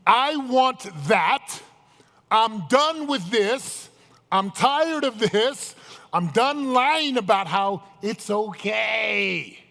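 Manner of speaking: loud, high and very slow, every word driven; aggressive declaration. Shown as a demonstration. Coach shows what being aggressive means, forceful declaration. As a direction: build to a defiant, drawn-out shout. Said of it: "To be aggressive means".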